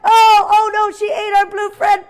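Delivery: high, loud pretend horror; mock alarm. Teacher tells class the yak ate their blue friend, loud mock alarm. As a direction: wail it with playful dismay.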